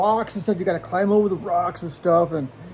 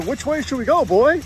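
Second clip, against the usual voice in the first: talking to a dog; 7 semitones higher, 3 dB louder than usual. Acted.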